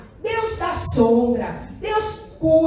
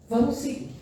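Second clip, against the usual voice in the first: ordinary talk, nothing marked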